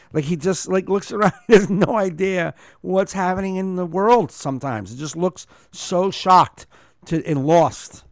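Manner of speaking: normal talking voice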